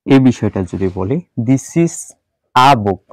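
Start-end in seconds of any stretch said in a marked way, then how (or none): 1.5-3.0 "a" said as a short "ah"; weak article. Shown as a demonstration. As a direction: say "a" as "ah" before "book". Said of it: "তখন এটার উচ্চারণ হচ্ছে".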